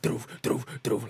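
repeated thudding noise made with the mouth; imitated slamming. Describing noises heard at home, imitated thuds. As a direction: mimic walls banging, one thud at a time. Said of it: "like, some walls slamming".

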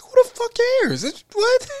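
very high, drawn-out squeal of disbelief; exasperated falsetto outburst; incredulous squeal. Real, not acted. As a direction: shoot up into a squeaky, laughing protest. Exasperated rant breaking into laughter.

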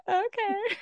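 high, put-on reaction to a frantic pet; high-pitched mock okay. Host retelling her reaction to the cat losing it, high put-on voice.

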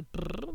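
lip-trilled "brr" like an engine; brief demonstrative brr; imitated car noise. Shown as a demonstration. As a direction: imitate a toddler's car sound. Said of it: "będzie oznaczało auto".

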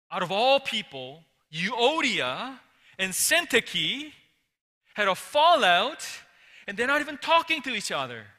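loud, urgent telling of shocking news; scandalized report. Retold or imitated. Epaphroditus tells Paul about the feud, loud and shocked. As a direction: blurt out the gossip, loud and aghast.

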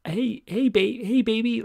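high-pitched mock Queens accent; attempted Queens accent. Shown as a demonstration. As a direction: try out a badly faked Queens accent. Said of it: "for a Queen's accent".

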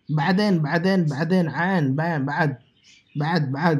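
"بعدین" with a squeezed throat catch after the b; ayn sounded. Shown as a demonstration. Teacher drilling the word, throaty ayn in "بعدین". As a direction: press the ayn in every "بعد". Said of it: "عین سے".

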